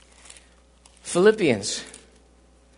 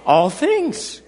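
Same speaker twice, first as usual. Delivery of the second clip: ordinary delivery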